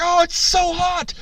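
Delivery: very high, drawn-out mock scream of pain. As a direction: screech it slowly as the burning eagle. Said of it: "When he's screaming".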